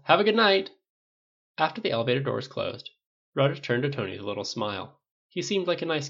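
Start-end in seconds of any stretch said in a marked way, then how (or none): 0.0-0.7 high, loud farewell, falling at the end; calling out. A narrator's reading. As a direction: call goodnight across the room. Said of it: "Rogers called".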